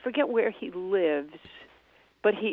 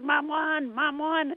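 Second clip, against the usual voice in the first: higher, lightly imitated kid's cheer; child's excited cheering; 8 semitones higher, much slower than usual. Son shouting to his sisters, imitated excited cheer. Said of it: "He's shouting into the phone".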